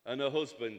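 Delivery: normal talking voice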